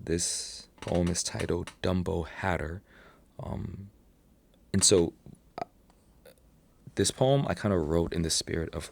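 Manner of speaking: ordinary talk, nothing marked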